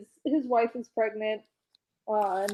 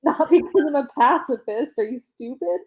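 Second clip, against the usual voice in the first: high-pitched incredulous rant; worked-up, higher-pitched retelling of outrage; acted exasperation; 5 semitones higher, 6 dB louder than usual. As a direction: berate them in mock disbelief, louder on the questions. Acting character's outraged, incredulous retort.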